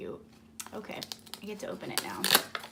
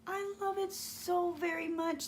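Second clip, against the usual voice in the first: usual speaking voice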